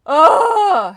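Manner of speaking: loud effortful yell; a big straining shout of effort; roaring grunt of exertion. Real, not acted. Alpha fight climax.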